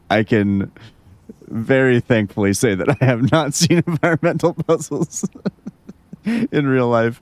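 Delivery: giggling through the words; laughing. Real, not acted. Laughing at the game.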